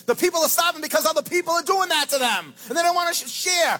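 loud, high shouted rant; angry preaching. Real, not acted. Pastor railing at billionaires who won't share, shouting. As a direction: shout it at the greedy.

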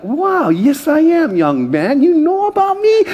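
preen, then squeak the question; flattered delight. Retold or imitated. Pastor flattered by the little boy, delighted surprise. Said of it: "And I'm like".

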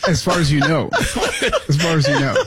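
words spilling through laughter; laughing. Real, not acted. Cohost teasing the host about barking, laughing.

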